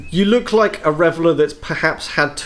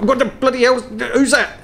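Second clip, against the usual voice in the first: high, startled bloke voice; startled Aussie tradesman; 9 semitones higher than usual. Acted. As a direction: call out alarmed like a tradesman. Barkeep startled by slammed door.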